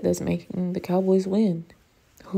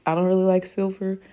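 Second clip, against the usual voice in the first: usual speaking voice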